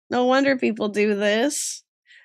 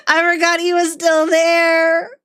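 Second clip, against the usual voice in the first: higher, laughing disbelief; laughing after a fright; 6 semitones higher, 4 dB louder than usual. Real, not acted. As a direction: crack up after being startled. Startled, cracking up at forgotten figure.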